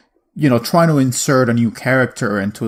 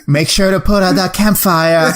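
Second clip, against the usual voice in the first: high, louder nagging voice through laughter; joking podcast host hams up a dead wife who nags from beyond, high and laughing; nagging ghost wife; 7 semitones higher, 4 dB louder than usual. Pretend. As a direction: scold like a ghost wife fussing over chores. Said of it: "Nagging you from beyond the grave".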